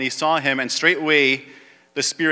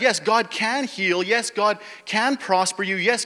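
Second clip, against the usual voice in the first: usual speaking voice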